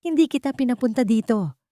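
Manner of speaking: restrained narrated shout. A narrator's reading. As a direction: snap back at her in anger. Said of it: "sigaw ni Ramon".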